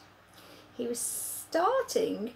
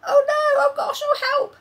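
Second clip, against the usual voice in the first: loud, much higher panicked cry; disgusted cry; 11 dB louder than usual. Acted. Sophie spitting out Snoscumber, loud disgusted cry. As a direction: squeal out in disgust.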